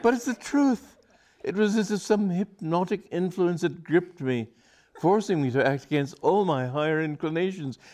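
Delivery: high, earnest pleading; passionate plea. Acted. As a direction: beg her to understand. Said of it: "he cried passionately".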